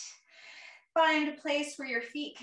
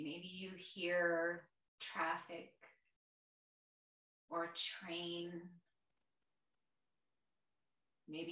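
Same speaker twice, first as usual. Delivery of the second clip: guided meditation; 3 semitones lower, 6 dB quieter than usual. Real, not acted. Yoga teacher guiding a chair class through their senses, soft meditation voice. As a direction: gently guide them inward, quieter and lower than chat.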